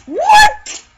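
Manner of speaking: very high surprised squeak; loud high rising what; shocked what. Real, not acted. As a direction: pop it up high in disbelief. Surprise at outcome.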